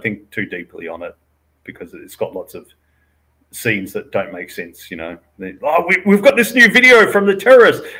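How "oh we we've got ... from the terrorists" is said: high, loud mock-urgent announcement; mock breathless alarm. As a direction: burst out with hammed-up movie urgency. Mock movie line, excited alarm.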